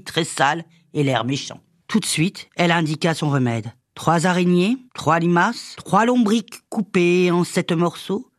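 usual speaking voice

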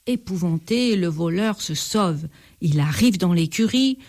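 usual speaking voice

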